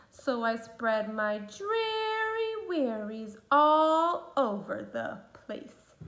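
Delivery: slow, drawn-out sad moan; gloomy fish refrain. Acted. The pout pout fish tells the sea his gloomy refrain, slow and sad. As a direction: moan it out slowly like a sulky fish.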